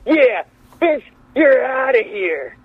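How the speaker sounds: high, drawn-out order to leave; mock wrestler's dismissal. Wrestler kicking the producer out, hammed-up high call. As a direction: imitate a wrestler throwing someone out.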